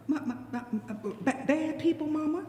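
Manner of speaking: put-on small, soft stammering child voice; stutter, then lift into a soft question; timid stammer. Frog questions Mama's warning, stammering timidly.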